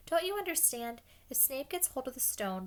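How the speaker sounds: high-pitched, urgent protest; shouting. A narrator's reading. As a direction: make them understand, heated. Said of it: "Harry shouted".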